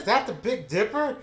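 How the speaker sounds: high, loud eager question; girlfriend's excited question. Retold or imitated. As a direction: ask it like you just spotted it. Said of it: "she goes".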